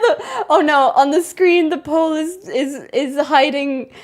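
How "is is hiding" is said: normal talking voice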